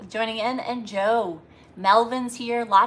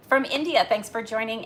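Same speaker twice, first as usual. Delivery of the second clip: ordinary talk, nothing marked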